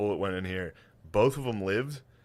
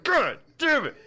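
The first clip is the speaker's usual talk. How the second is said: high, loud cursing shout; mock pained shout; 14 semitones higher, 5 dB louder, slower than usual. Shot victim cursing, high loud shout. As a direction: yell the curse, hurt and laughing. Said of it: "and be like".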